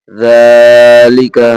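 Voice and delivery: জালিকা with the জা held long; slow teacher recitation of জালিকা; drawn-out recitation. Shown as a demonstration. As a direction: stretch the first জা of জালিকা. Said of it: "জা খারা যাবার জা".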